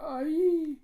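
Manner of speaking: high, loud startled yelp; sharp yelp. Retold or imitated. A player caught in the fight: a high, loud startled yelp. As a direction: let out a sudden sharp cry.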